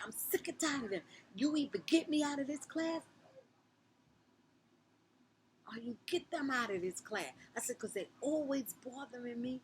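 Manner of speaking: high, loud furious rant; venting rage. Retold or imitated. To the teacher about the bullies, a high furious rant. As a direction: pour it all out at the teacher. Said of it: "Oh, I just went in".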